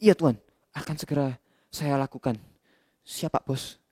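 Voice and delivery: low, soft obedient reply; lowly servant answering his master; dutiful slave. Acted. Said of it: "katanya budak teladan".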